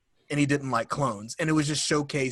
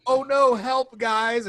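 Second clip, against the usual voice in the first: higher, louder fake cry for help; mock helpless Palpatine; 9 semitones higher, 4 dB louder, slower than usual. Mocking Palpatine pretending to need help, higher and louder. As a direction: ham up a fake damsel plea. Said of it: "like, go".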